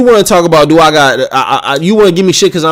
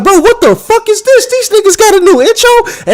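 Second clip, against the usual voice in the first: much higher, incredulous squeal; baffled shock. Retold or imitated. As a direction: play your younger self stunned by a new intro. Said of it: "So I was like".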